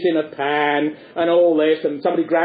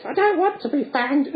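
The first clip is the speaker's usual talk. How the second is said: higher, lightly voiced Punch; Punch mishearing; 9 semitones higher than usual. Punch mishearing the policeman, light puppet voice. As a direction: play deaf and silly. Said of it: "he always says".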